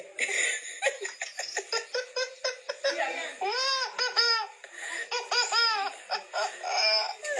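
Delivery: long, breathless high laugh; high-pitched laughing fit. Real, not acted. Amused by child's remark about plane.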